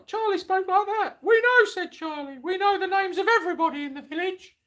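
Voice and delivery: very high, squeaky Muppet voice. Acted. Charlie tells Mister Fullwood they know everyone, in a Muppet voice. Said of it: "You sound like a Muppet".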